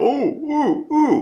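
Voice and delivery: mocking whiner. Mocking people who piss and moan with a high whiny falsetto. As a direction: whimper like a self-pitying moaner.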